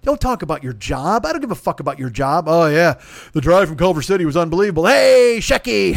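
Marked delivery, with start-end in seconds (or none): 2.5-5.9 hammed-up chummy rich-guy voice, louder; mocking rich guys. Comic mocks rich fans at the table, hammed-up chummy voice. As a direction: lampoon the backslapping bigwigs.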